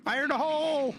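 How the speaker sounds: high shouted callout; grenade fuse. Real, not acted. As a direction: yell the warning to teammates. Throwing a grenade in game.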